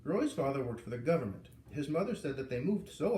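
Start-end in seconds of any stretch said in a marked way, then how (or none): none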